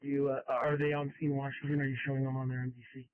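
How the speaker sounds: crackly construction walkie talkie. Real, not acted. Scanner radio dispatch traffic.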